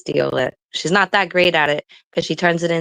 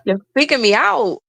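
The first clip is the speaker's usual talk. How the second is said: high-pitched, weirded-out protest; retold alarm; 7 semitones higher than usual. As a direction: replay being freaked out by a friend. Retelling her alarmed message.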